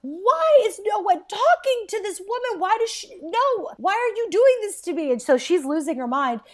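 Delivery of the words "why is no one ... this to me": much higher, hammed-up pleading outburst; mock distraught. Catherine begs the family, high mock hysteria. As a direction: play her losing it, pleading. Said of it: "Catherine starts losing her shit".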